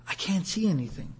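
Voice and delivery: very soft, understated acted dismay; hushed realisation. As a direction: say it quietly, as the newly blind man. Man realises he is blind.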